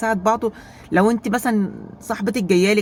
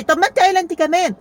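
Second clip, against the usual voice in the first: higher, louder retort in a quarrel; heated retort; 10 semitones higher, 6 dB louder, faster than usual. Retold or imitated. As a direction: fire back at your sister, raised. Acted sister quarrel climax. (رد غاضب بصوت عالي)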